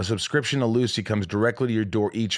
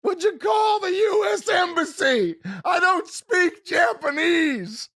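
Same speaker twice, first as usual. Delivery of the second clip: high, slow shouted Farley bellow; Chris Farley impression; slower than usual. Quoting his favorite Farley line in a high shouted bellow. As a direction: belt it out like Farley panicking. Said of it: "favorite Farley quote".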